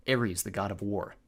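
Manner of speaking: usual speaking voice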